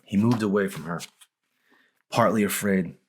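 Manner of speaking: ordinary delivery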